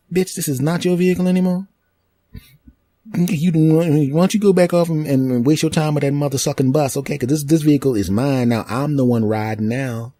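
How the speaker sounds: high, cocky put-on woman's voice; sassy lady. New owner sassing the former owner, cocky. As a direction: taunt her with attitude.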